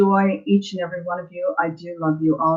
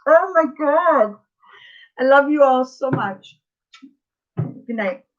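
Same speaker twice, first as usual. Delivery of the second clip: on the verge of tears; 7 semitones higher than usual. Real, not acted. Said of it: "I'm gonna cry".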